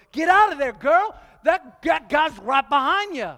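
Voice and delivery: loud, high shout of warning at the movie; hollering at the girl on the screen to run; frantic moviegoer's shout; yelling at the screen. Retold or imitated. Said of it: "actually will actually yell".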